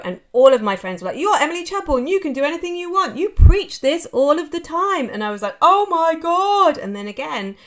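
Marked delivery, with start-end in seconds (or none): 1.2-5.1 high, fast, gushing encouragement; lightly voiced excited friends; mock cheerleading friends. Friends' enthusiastic encouragement quoted. 5.6-6.8 high, slow, drawn-out gasp of words; hammed-up touched reaction; mock overwhelmed. Her overwhelmed reaction quoted.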